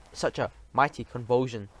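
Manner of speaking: ordinary delivery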